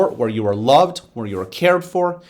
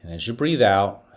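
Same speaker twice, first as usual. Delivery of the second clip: guided meditation; 4 semitones lower than usual. Acted. Principal guiding students through a breathing exercise, calm meditation voice. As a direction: ease the kids into calm, one breath at a time.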